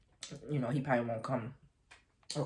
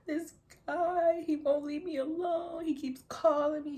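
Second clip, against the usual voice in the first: high, wailing, tearful voice; crying; 4 dB louder, slower than usual. Retold or imitated. Telling a friend about the stalker, crying hard. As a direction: sob it out much higher and louder. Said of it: "I just started crying like".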